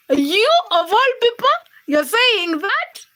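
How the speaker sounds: high, slow teasing disbelief; you of all people, draw it out; playful scolding of a viewer; mock disbelief. Real, not acted.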